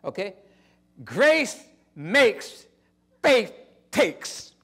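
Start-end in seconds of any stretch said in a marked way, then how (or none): 1.0-4.5 loud, high proclaiming; preaching. Acted. Preacher proclaiming to the congregation, loud. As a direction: preach it out to the church.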